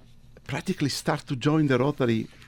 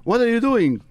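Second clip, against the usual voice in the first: surprised; 9 semitones higher, 5 dB louder than usual. Retold or imitated. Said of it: "they said to me".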